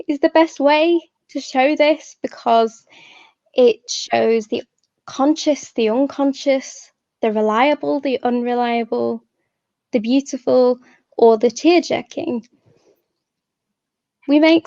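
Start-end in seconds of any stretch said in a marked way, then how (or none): none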